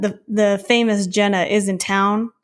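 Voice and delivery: ordinary talk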